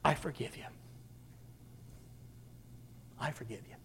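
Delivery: very soft and low, fading away; a faint, fading forgiveness; dying last breath. A narrator's reading. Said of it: "with his last breath".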